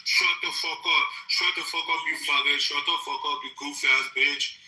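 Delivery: mumbling with marbles in mouth; slurred, mumbled mock tirade. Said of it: "you do a really good impression of him".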